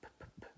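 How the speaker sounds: "p, p, p," as bare voiceless puffs, very soft. Shown as a demonstration. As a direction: pop "p, p, p," using only air, no voice. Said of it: "doesn't make your vocal cord vibrate".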